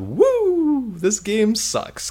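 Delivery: high, hammed-up whoop of a badly acted game character; mocking bad game voice. Host mocking the game's bad voice acting, hammed-up high whoop. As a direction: parody the game's cringey voice acting, high and slow. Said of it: "everybody just has the worst accent".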